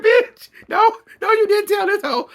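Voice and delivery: high, loud shriek of disbelief; loud, high laughing outburst; shocked laughing shout. Retold or imitated. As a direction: scream it through laughter, outraged. Her own shocked reaction, laughing.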